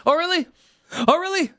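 high sarcastic "Oh, really?" with a sneer; snide oh really. Shown as a demonstration. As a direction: toss off "Oh, really?" dismissively. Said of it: "the oh, reallys are not good".

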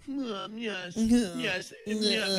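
a hint of nose-in-the-air posh approval; snooty. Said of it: "They got their nose up in the air".

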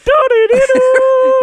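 very high, loud cracking laugh; real shrill laughter fit; shrieking laugh. Real, not acted. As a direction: burst into a squealing laugh. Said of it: "Neydi o?".

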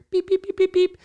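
high, loud electronic beeps; watch beeps. Retold or imitated. Runner imitating his sports watch beeping, high and loud.

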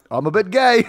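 lightly hammed-up one-liner; comic character line. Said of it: "all of which are like".

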